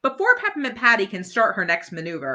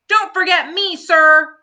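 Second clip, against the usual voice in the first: much higher, loud chime-in; eager Marcy; 10 semitones higher, 10 dB louder than usual. Acted. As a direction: insist on being counted. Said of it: "Marcy adds".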